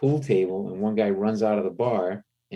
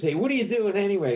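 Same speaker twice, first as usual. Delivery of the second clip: quick, high-pitched put-on voice; retold nosy question, pitched up; imitated drunk heckler; 12 semitones higher, faster than usual. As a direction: butt in as the bar drunk. Drunk man questions the comic.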